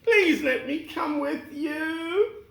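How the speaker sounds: high, loud, frightened begging; cowardly lion pleading. Acted. The scared lion begging Dorothy's group to spare him, high and loud. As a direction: plead for mercy, scared stiff.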